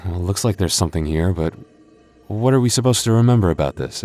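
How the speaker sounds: usual speaking voice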